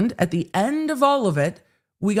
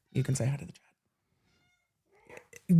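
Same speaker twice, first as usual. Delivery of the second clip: whisper; 6 dB quieter, much slower than usual. Real, not acted. Whispering to her teenager who walked in. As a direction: hush it, slow and breathless. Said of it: "whisper to teen".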